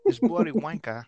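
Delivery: mock British accent. Joining the British mockery, mock British accent. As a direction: spit it like a cartoon Brit.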